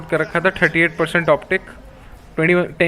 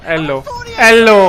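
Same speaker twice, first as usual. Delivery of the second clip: loud drawn-out cheer; excited shout; 4 semitones higher, 7 dB louder, much slower than usual. Real, not acted. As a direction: shout it slowly, thrilled. Cheering a clutch round.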